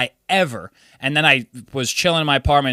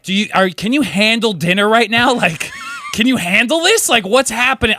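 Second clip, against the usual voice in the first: exasperated questioning; 8 semitones higher, 3 dB louder than usual. Retold or imitated. Questioning drunk friend.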